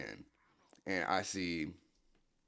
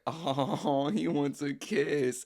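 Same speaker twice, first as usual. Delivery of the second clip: much higher, louder, slow mocking coo; teasing older girl; 9 semitones higher, 8 dB louder, much slower than usual. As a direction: coo it like a girl laughing at a kid. Hannah teasing the thirteen-year-old.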